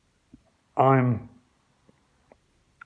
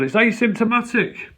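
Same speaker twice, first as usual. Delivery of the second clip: mock reply; 8 semitones higher, 5 dB louder than usual. His reply, repeated.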